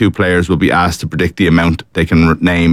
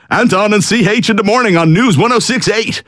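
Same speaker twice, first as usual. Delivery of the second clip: very high, sung-out station ident; hammed-up morning radio announcer; radio show announcer. Host pitches a renamed morning show, high hammed-up announcer voice.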